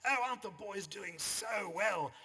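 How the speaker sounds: high, loud, slow gushing society mother; rowing mum gushing over the boys, hammed-up posh. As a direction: overdo the plummy North Shore mother. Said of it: "'cause women spoke like that in those days".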